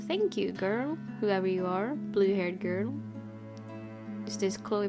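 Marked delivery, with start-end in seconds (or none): none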